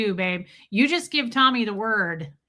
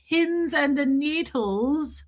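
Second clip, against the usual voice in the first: drawn out; 5 semitones higher, much slower than usual. Acted. Drawn-out phrase.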